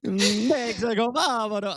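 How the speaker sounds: slow, high, hammed-up movie-villain accent; Dracula or Mario voice. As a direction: drag out the title like a cartoon villain. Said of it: "couldn't tell if that was Dracula or Mario".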